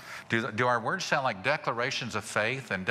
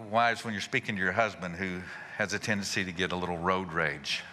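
ordinary talk, nothing marked

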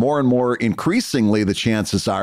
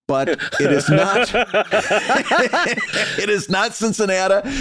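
laughing; 7 semitones higher, slower than usual. Real, not acted. Laughing correction of pronunciation.

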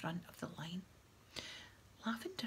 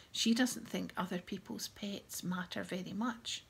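plain reading voice

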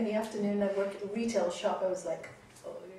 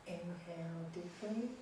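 soft spa whisper; 3 semitones lower, 20 dB quieter than usual. Acted. Guided breathing final rounds.

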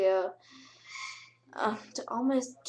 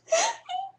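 excited squeal; 5 dB louder than usual. Real, not acted. Excited about meeting Ashton Kutcher.

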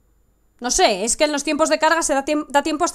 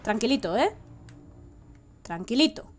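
soothing hush. Real, not acted. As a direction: settle the little guy down gently. Calming the game character.

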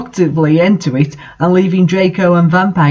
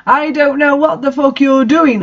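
higher, hammed-up indignant yell; mock angry shout; 7 semitones higher than usual. A narrator's reading. Heroine yelling back at the professor, mock angry. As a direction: fire it back with put-on outrage. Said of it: "I shouted angrily".

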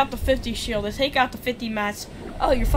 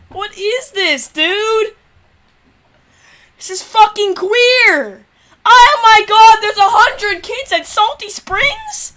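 high, loud and slow; loud exasperated rant; 9 semitones higher, 10 dB louder, slower than usual. Real, not acted. Streamer ranting at a crowded landing spot, loud slow rant. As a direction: drag each word out in disgust.

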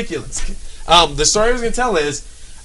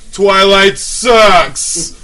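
loud, very slow smug jeer; 3 semitones higher, 6 dB louder, much slower than usual. Retold or imitated. Heckler in the rows in front jeering at a line. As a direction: drag it out like a proud wisecrack. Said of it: "is like".

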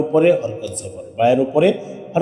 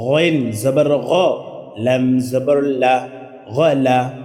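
slow, sing-song syllable spelling; slow spelling drill; much slower than usual. Shown as a demonstration. Teacher demonstrates spelling for the class, slow chant. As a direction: spell each letter slowly, then read the word. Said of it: "পড়তে হবে কি".

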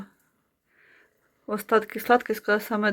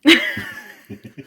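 high burst of laughter; real amused chuckle; 7 semitones higher than usual. Real, not acted. Amused at the last dumpling.

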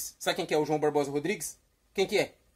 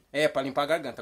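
usual speaking voice

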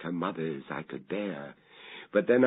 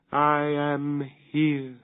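put-on a slow, eerie, higher child's voice, cold and distinct; cold, calm and distinct; 7 semitones higher, 4 dB louder, slower than usual. A narrator's reading. Daughter answering from the vault, cold, calm and slow. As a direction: speak like a ghost calmly answering her name. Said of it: "coldly, calmly distinct".

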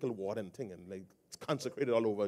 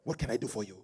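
put-on African accent; 2 semitones lower than usual. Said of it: "my best African accent".

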